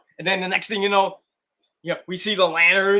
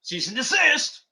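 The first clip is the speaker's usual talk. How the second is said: mocking WWE; 7 semitones higher than usual. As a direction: play the uptight company lawyer. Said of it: "WWE was like".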